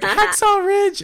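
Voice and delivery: put-on very high little-kid voice; squeaked-up imitation of a small child; toddler imitation. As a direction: mimic a toddler, high and loud. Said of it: "with this two-year-old kid".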